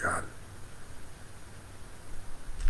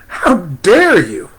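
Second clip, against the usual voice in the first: slow, loud, high "How dare you"; angry rebuke; 7 semitones higher, 11 dB louder, slower than usual. Retold or imitated. Amma turning round on the follower, angry rebuke. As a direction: snap it out as an indignant teacher. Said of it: "a little irritation, a little anger, and said".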